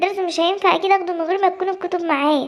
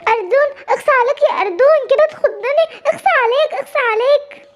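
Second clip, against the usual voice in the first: higher, shaken scolding after a fright; startled reproach; 6 semitones higher than usual. Acted. Simba, just scared by Ardon, scolds him in a high startled voice. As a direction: scold him while still jumpy.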